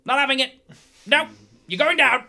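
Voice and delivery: high, loud, short bursts of defiance; nervous bravado. Acted. Dwarf facing down a roaring giant, nervous bravado. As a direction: put up a brave front as nerves go. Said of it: "you can see his nerves starting to go".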